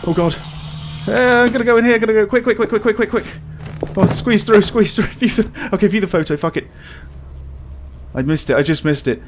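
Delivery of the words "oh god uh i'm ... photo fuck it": panicked shouting while fleeing; frantic, loud, rushed panic; being chased. Real, not acted. Fleeing monster in horror game.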